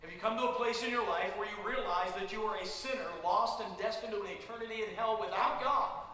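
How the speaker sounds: loud, urgent altar-call preaching; forceful preaching. Acted. Preacher calling sinners to respond, preaching loudly. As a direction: press the listeners to respond.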